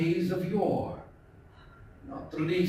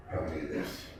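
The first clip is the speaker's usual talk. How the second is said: usual speaking voice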